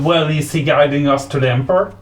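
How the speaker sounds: normal talking voice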